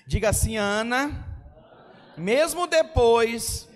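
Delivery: loud, slow, shouted sermon climax; preaching at full force. Acted. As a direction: drive it home to the congregation, full voice. (pregação gritada com toda força)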